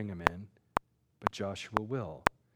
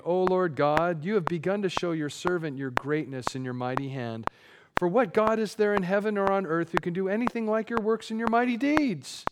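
raised, building voice of praise to God; fervent prayer; 6 semitones higher, 3 dB louder than usual. Acted. Preacher reads Moses praising God, fervent and rising. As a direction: cry out a prayer, rising higher and louder. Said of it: "Moses is praying".

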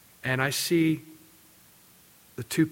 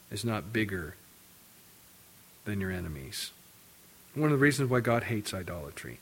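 ordinary talk, nothing marked

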